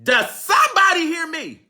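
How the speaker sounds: high loud call to the room. Real, not acted. Preacher calling out to the congregation, high and loud.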